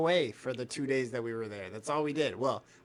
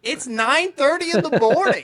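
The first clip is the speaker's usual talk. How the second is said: much higher and louder, shocked disbelief; a stranger scandalised at the early drinkers, shrieking; incredulous outburst; 13 semitones higher, 13 dB louder than usual. Retold or imitated. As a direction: squeal it in total disbelief, high and loud. Girl incredulous at queue.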